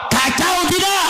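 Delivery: rousing shout. Acted. Preacher shouting the question back at the crowd. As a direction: shout it at the congregation.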